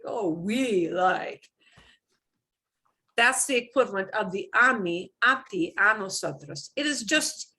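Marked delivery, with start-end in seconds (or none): 0.1-1.4 "oh, we like" slow, leaning on "we"; punched we like. Shown as a demonstration. Teacher shows emphatic we to a student, slowly. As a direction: drag out and stress the "we". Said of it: "So when we punch that".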